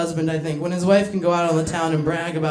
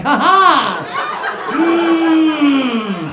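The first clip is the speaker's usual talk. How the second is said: loud, high "ha ha" and a long grunt; hammed-up gloating laugh, then a straining "mm"; mock triumphant cackle; 6 semitones higher, 5 dB louder, much slower than usual. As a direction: crow loudly in mock victory. Acting out a gloating attack.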